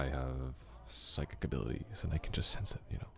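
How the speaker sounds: breathy secret whisper, as if sharing magic; mock mysterious whisper. Teasing the guest with a whispered psychic claim, mock mysterious. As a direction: whisper it like a party trick.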